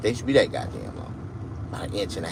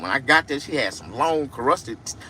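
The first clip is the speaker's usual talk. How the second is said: usual speaking voice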